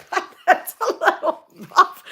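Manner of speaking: high-pitched, laughing through the words; cracking up. Real, not acted. Reacting to the body-washing idea, cracking up. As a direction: break into laughter while speaking. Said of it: "She had me cracking up".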